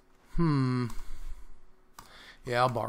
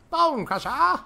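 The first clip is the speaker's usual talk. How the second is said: much higher, rising Bone Crusher; high-pitched call; 13 semitones higher, 3 dB louder, slower than usual. Acted. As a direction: squeak it up high. High squeaky repeat.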